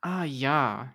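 put-on German-accented exclamation, falling at the end. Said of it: "I can do a German one".

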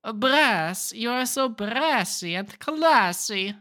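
hammed-up seduction in a silly foreign accent; put-on European accent. Shown as a demonstration. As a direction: stretch the compliments in a fake European accent. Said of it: "I will talk like this".

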